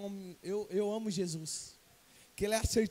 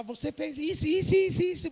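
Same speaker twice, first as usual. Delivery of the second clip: higher-pitched, rising telling-off; scolding parent; 6 semitones higher than usual. Acted. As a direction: nag the child, piling word on word. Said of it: "Repreender, você chega pro seu filho e fala". (bronca de mãe no filho)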